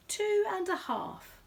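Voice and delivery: high, slow bear voice; Paddington voice. Acted. Bear answers the quizmaster, high and slow.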